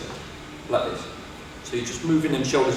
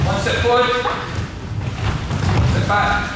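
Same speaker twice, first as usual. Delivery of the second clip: calling out steps; 6 semitones higher than usual. Real, not acted. Coach drilling pupils in footwork, calling out. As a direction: call each step to the group.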